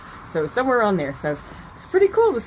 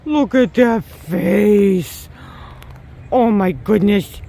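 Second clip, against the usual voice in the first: cooing; 3 semitones lower, 5 dB louder, slower than usual. Real, not acted. Talking to deer.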